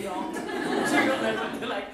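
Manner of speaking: laughing through the words. Retold or imitated. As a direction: giggle while protesting. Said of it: "and I'm like".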